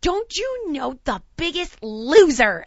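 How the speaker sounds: drawn-out mock maternal boast; bragging mom. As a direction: announce it proudly like a boasting mom. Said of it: "And she goes".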